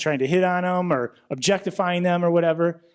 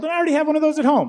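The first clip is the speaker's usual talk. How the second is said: high, fast, louder brag; a quick pitched-up triumphant aside; gleeful punchline; 10 semitones higher, 4 dB louder, faster than usual. Acted. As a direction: blurt it out like a smug boast.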